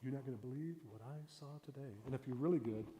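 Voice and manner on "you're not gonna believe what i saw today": hushed teaser. Shown as a demonstration. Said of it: "when you say that".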